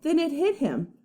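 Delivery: ordinary delivery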